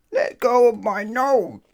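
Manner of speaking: high, loud, slow cry through a pinched nose; pained cry. Acted. Mister Mischief with his nose held by the wizard, pained cry. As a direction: yelp while your nose is held. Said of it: "cried Mister Mischief".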